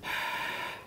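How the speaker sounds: long voiceless fogging breath; soft open-throated exhale; breathy exhale. Shown as a demonstration. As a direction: huff on glass to clean it. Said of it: "Or you wanna clean the glass".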